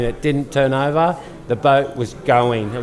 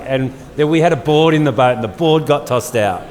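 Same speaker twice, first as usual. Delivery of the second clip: normal talking voice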